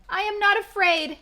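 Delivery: high, loud shout of fake courage; defiant shout. Trembling Frog shouting that he is not afraid. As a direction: yell it to hide the fear. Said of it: "he shouted".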